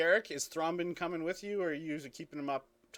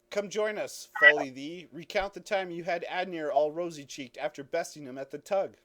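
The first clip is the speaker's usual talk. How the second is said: hearty raised-voice invitation; yelled up; 3 semitones higher, 8 dB louder than usual. Acted. Guard to sergeant by the cliff, calling up to join the fire. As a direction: call up over the wind. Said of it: "you can hear Gix yell up through the wind".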